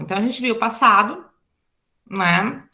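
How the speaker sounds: usual speaking voice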